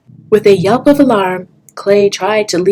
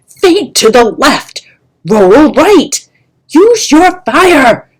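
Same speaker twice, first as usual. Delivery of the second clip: loud, high, slow commands; barked orders; 8 semitones higher, 9 dB louder, slower than usual. Acted. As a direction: yell drills at the student. Trainer barking combat orders.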